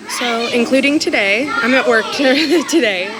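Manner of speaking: loud laughing aside; laughing while talking at work. Real, not acted.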